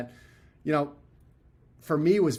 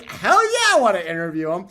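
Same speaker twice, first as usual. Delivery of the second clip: much higher, louder burst of delight; excited yes; 6 semitones higher, 9 dB louder than usual. Retold or imitated. Asked whether he wants the interview, an excited higher, louder yell. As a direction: jump at the chance, thrilled.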